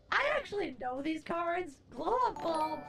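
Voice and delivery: usual speaking voice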